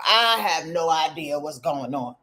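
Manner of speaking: ordinary talk, nothing marked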